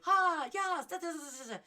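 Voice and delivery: high, loud bubbly chatter; hyper happy self. Retold or imitated. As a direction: bounce through it like a party girl. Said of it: "I'm very animated".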